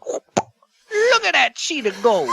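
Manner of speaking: high, slow goofy voice; goofy high drawl; slow high silly voice. Retold or imitated. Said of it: "And then he just stops. Like".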